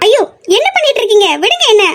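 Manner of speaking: high-pitched, flustered plea to let go; startled protest. Acted. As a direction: protest in alarm at being suddenly grabbed. Startled protest at being grabbed.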